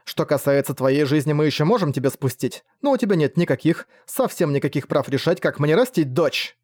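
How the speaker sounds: usual speaking voice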